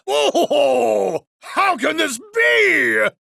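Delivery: high, slow, hammed-up cry of disbelief; shocked outcry. Defeated showman crying out at his loss, hammy and high. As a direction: bellow in theatrical disbelief. Dio losing, caught off guard.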